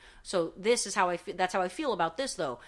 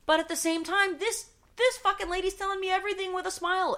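worked up; 13 semitones higher, 5 dB louder than usual. Real, not acted. Ranting about smiling clerk.

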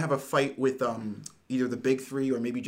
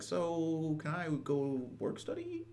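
soft, dragged-out mock question; drawn-out timid request; 2 semitones higher, 12 dB quieter, much slower than usual. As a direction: stretch it out slowly and very quietly. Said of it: "Midoriya's like".

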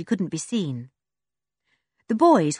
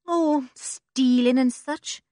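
slow, offhand and evasive; vague; 6 semitones higher, slower than usual. A narrator's reading. Joe dodging the question about dirty work, slow and vague. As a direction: shrug it off slowly. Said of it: "said Joe vaguely".

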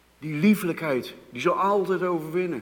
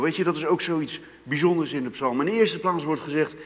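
usual speaking voice

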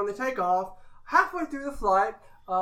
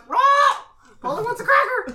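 high, loud parrot shout; loud squawking parrot cry; 13 semitones higher, 9 dB louder, faster than usual. Acted. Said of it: "the parrot replies".

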